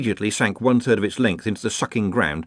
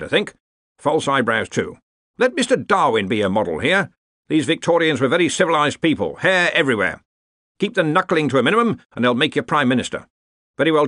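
lightly voiced commanding academic, higher pitched; insistent Arch-Chancellor; 6 semitones higher, 3 dB louder than usual. A narrator's reading. Arch-Chancellor instructing gentlemen on their disguises, lightly commanding. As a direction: give orders as a self-assured chancellor.

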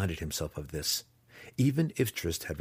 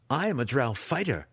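declared; 6 semitones higher than usual. A narrator's reading. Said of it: "he declared".

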